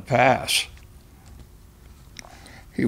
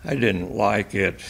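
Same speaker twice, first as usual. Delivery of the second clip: plain reading voice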